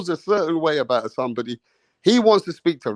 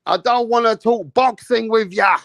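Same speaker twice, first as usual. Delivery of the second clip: higher, louder dismissive yell; mock angry yell; 7 semitones higher, 4 dB louder, slower than usual. Imitating the guest shouting at Mirage, mock yell. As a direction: shout him down as the rival. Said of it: "He said".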